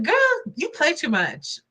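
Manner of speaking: put-on Girl swoops far up, then drops to teasing; sassy mock scolding. Telling the host she plays too much, sassy teasing. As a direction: tease her like a friend calling her bluff. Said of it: "I'm like".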